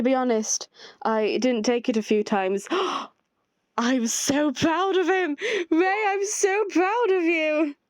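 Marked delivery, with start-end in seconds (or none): 2.7-5.4 slow, high, gushing pride; breathless delighted praise; proud gasp. Acted. Gasping, proud exclamation read. 5.7-7.7 much higher, slow and doting; doting sing-song praise; gushing pride. Acted. Repeated proud exclamation to Ray.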